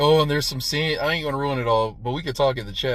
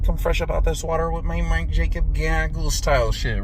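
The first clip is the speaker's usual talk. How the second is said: normal talking voice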